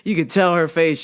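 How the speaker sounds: ordinary delivery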